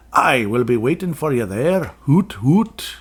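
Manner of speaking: put-on light Scottish accent ending in a high hoot; a hint of Scottish owl, hooting at the end; lightly voiced Scottish owl. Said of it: "Does that not sound Scottish?".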